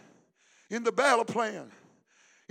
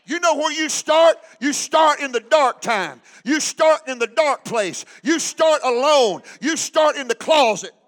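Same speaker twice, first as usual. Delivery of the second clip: preaching at full force; 3 semitones higher, 9 dB louder than usual. Acted. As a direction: hammer each "start" at the congregation.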